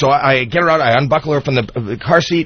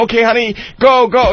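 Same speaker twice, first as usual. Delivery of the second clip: put-on urging toddler; 7 semitones higher than usual. Father urges his daughter to pee by the freeway, high and pressing. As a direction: hurry the little one along.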